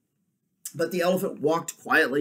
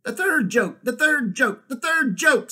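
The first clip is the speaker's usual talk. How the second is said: high, loud bird-like squawking; excited jackdaw; 13 semitones higher, 7 dB louder than usual. Acted. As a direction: cry it out higher each time, thrilled. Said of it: "said the jackdaw in great excitement".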